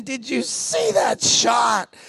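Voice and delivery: slow, drawn-out yelling of a winner; excited shout. Retold or imitated. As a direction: shout it like a jubilant player. Boys celebrating a win.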